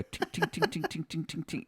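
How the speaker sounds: rapid high, light ting-ting-ting; quick little high-pitched clinking noises; imitated metallic tinging. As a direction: mimic a knife jangling in a coin slot. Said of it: "from the kitchen and go".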